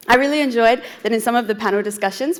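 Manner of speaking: usual speaking voice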